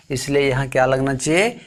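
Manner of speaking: normal talking voice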